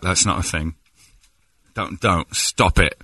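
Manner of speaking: ordinary talk, nothing marked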